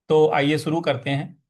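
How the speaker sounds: ordinary delivery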